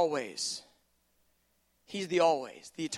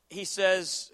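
ordinary delivery

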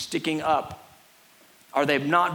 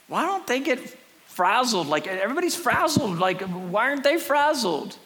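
mildly exasperated, higher-pitched disbelief; acted bewilderment, pitched up; baffled onlooker; 7 semitones higher than usual. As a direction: voice someone puzzled by another's calm. Voicing baffled onlookers of calm people.

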